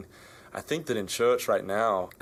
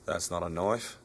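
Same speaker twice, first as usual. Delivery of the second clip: put-on Australian accent; 3 semitones lower, 5 dB quieter than usual. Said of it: "in your best Australian accent".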